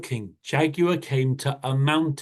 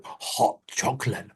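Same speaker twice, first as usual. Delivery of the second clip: hushed greedy thought; whispered thought; 2 semitones lower, 6 dB quieter than usual. Acted. Jaguar dreaming of hot chocolate, whispered. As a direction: whisper it to yourself.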